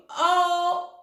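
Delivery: loud, high yell of Oh. Acted. O the vowel yelling his own name at the party. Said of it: "he started to yell out his name".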